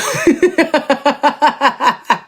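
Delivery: high cackling witch laugh; witch cackle. Real, not acted. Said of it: "J'avoue, c'est un rire de sorcière".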